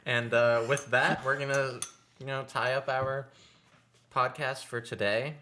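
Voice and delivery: loud, high host sign-off; podcast outro voice. Acted. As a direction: wrap up like a radio host. Wrapping up the show.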